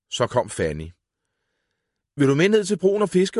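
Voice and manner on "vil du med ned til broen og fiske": Karl calls out high and loud; eager boy's shout, high and loud. A narrator's reading. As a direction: shout the invitation across to Fanny. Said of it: "råbte Karl".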